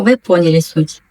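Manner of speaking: ordinary talk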